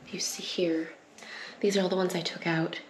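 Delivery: ordinary delivery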